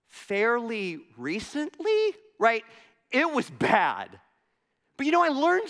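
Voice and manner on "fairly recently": "Fairly recently?" mimicked back slowly as a question; echoed questioning answer. Repairman throwing the homeowner's answer back, slow mimicked question. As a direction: parrot "Fairly recently?" back, drawn out with a rising end. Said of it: "he says this back at me".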